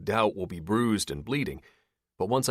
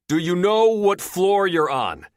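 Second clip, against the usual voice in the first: loud, slow, higher shouted question; man shouts a question to the trapped woman; calling out; 10 semitones higher, 8 dB louder, slower than usual. A narrator's reading. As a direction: call it clearly down the shaft. Shouting down elevator shaft.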